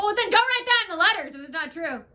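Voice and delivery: loud, high scolding; indignant scolding. Retold or imitated. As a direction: tell him off hard. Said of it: "I go".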